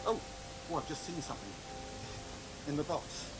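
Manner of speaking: usual speaking voice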